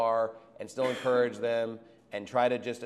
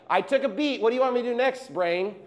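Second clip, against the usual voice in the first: fussy heart voice; 8 semitones higher than usual. Retold or imitated. Heart voiced asking the brain.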